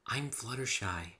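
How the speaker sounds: soft, slow, shy near-whisper; timid pony giving her name softly. A narrator's reading. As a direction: barely breathe your name. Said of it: "Her voice was very soft, barely louder than a whisper".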